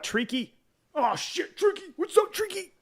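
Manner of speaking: silly high greeting. Acted. Streamer greets regular viewer Treaky, squeaky high-pitched call. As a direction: squeal the name like a running gag. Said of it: "I do it like that every time".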